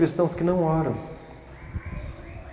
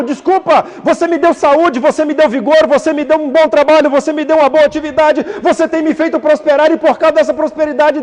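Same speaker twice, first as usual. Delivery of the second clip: loud, higher, hammed-up pleading excuse; mock excuse prayer; 8 semitones higher, 5 dB louder than usual. Preacher mocking busy believers apologizing to God, loud and hammed-up. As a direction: overplay the self-pitying apology. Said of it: "Você fala".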